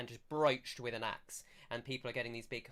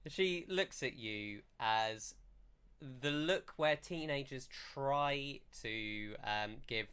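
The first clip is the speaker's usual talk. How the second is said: ordinary delivery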